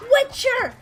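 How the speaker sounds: loud, very high shout of sudden recognition; eureka shout. Retold or imitated. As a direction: imitate someone blurting out the answer. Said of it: "like, a eureka moment".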